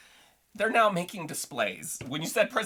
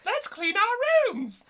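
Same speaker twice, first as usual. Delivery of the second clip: high, slow prim nanny puppet; sing-song British nanny puppet; little puppet voice; slower than usual. Retold or imitated. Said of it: "in her little puppet voice".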